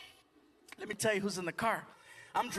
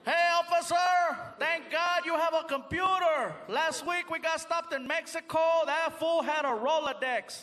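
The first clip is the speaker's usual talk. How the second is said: high, slow, drawn-out holler; yelling; 6 semitones higher, 3 dB louder, slower than usual. Retold or imitated. Back seat friend shouting to the officer, slow high yell. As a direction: call out loudly from far back, stretching words. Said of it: "he's being yelled at".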